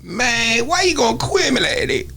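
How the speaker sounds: high-pitched mumbled hip hop imitation; mock a rapper slurring words together way up high; exaggerated garbled rap voice; garbled rapper impression. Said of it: "Where do they talk like this".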